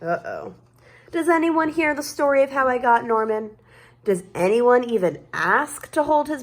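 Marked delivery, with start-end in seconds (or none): none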